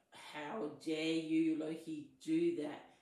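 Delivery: loud, slow, outraged giant; furious. Acted. Storm giant to Loki who gobbled the meat, furious. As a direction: draw it out loudly in indignation. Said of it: "He was furious".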